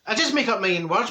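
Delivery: ordinary talk, nothing marked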